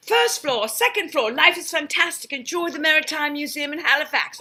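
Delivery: lightly voiced cheery British announcer; a hint of a chipper British tour guide; happier British elevator voice. Elevator greeting cruise passengers, happier British accent. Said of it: "a happier Brit".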